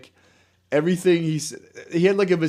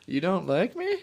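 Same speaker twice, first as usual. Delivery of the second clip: septa worker impression; 4 semitones higher, 6 dB quieter than usual. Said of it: "And again".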